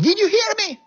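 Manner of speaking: high, loud angry demand; furious officer. Retold or imitated. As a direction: yell at the soldier who won't move. Captain furious at disobedience.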